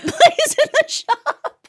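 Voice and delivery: high, breaking into laughter; laughing. Real, not acted. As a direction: laugh through the words. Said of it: "laughing while telling".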